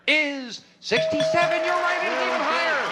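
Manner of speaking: excited reveal; game show host. Acted. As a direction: build to the answer.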